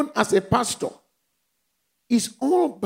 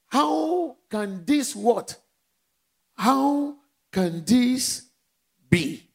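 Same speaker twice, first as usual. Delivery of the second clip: astonished Mary; 3 semitones higher, much slower than usual. Acted. Mary's astonished question, drawn out.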